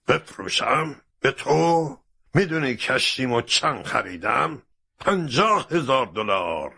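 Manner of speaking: high, indignant captain; outraged questions, pitched well up; angry disbelief. A narrator's reading. Captain refusing to sell his ship, angry and incredulous.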